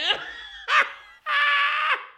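very high, loud shouted laugh; loud yelling laugh. Real, not acted. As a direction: howl in pained disbelief. Reacts to the Steelers loss.

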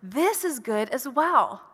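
slow, pleased voice of God; approving God voice. Pretend. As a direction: savor each word as the Creator admiring. God's voice quoted, approving.

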